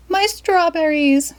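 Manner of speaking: very high, slow wail; lady dismayed at her strawberries; Missus Fitzwizzle cries. Acted. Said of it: "cried Missus Fitzwizzle".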